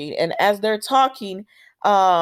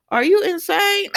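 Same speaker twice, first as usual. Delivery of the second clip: laughing disbelief; 11 semitones higher than usual. Retold or imitated. Laughing at Nina on screen.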